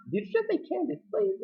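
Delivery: high, soft put-on voice; high gloating question. She savours his begging, high mocking voice. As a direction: squeal it back, relishing the plea. Acting out her gloating reply.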